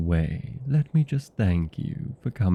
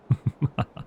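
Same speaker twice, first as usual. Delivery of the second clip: short, staccato villain laugh; theatrical "ha-ha-ha"; evil vampire laugh; 5 semitones higher than usual. Acted. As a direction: cackle after the threat. Vampire showing off scary act.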